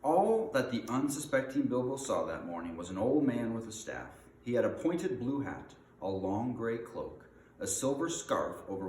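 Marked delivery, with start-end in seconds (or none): none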